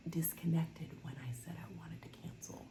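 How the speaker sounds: whispered aside, no voice. Real, not acted. Telling viewers about the cancel chat, whispered. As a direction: drop to a secret whisper.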